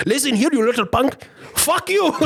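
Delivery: high-pitched mock angry scammer; imitating cussing out. Scammer cussing out the caller who stalled, mock angry. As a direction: put on a squeaky outburst, pitch jumping up. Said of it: "proceeds to cuss me out".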